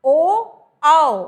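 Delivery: ओ and आओ stretched very slowly and loudly; slow drawn-out demo. Shown as a demonstration. Teacher repeating the vowels for students, very slow and loud. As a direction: drag out ओ, आओ so every shape is heard. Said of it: "फिर हमने बोला".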